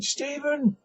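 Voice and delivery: high, loud call to wake him; calling his name. Acted. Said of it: "He calls again".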